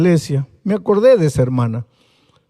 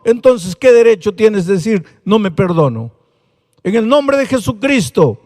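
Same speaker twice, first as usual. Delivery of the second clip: louder, higher fiery sermon climax; preaching at full force; 6 semitones higher, 5 dB louder than usual. Acted. Preacher commanding the listener to rise and accept pardon, fiery and loud. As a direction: thunder the call to accept forgiveness.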